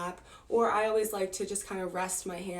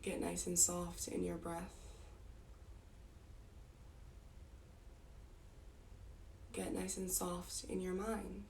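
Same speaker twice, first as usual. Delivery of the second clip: soft meditation voice, sinking quieter toward the end; guided meditation; 8 dB quieter than usual. Real, not acted. Teacher relaxing the group's bodies part by part, soft guided meditation. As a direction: lull them gently, phrase by phrase with long pauses.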